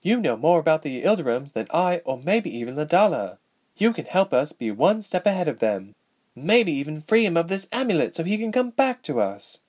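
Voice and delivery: lightly acted, hopeful princess appeal; young princess, pleading. A narrator's reading. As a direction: press her to join your cause. Narrator voices the princess.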